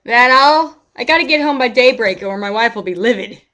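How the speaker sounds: put-on a folksy old neighbour lamenting; old man NPC.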